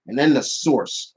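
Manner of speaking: ordinary delivery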